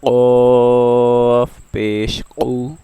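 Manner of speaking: teacher demonstrating the heavy qaf; slow spelled recitation. Shown as a demonstration. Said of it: "आइए practice करते हैं".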